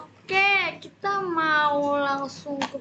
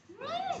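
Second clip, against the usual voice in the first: hum of delight; 8 dB quieter than usual. Real, not acted. Tasting food.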